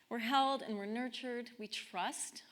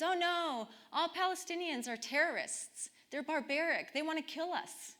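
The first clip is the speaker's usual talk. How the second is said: put-on higher voice for the fifteen-year-old sister; lightly played young girl, pitched up; teenage sister; 7 semitones higher than usual. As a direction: voice her as a girl repeating what she has heard. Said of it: "And she says".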